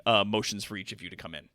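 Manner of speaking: ordinary delivery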